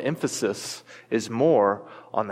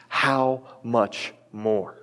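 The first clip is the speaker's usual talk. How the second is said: very slow, falling, weighty "How much more?"; slow emphasis; much slower than usual. Shown as a demonstration. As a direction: lay "How much more?" down slowly and firmly. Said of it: "underline that little phrase".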